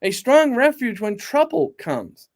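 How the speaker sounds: slow, weighty "strong refuge"; slow emphasis. Shown as a demonstration. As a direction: lean on "strong", take it slowly. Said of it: "remind you".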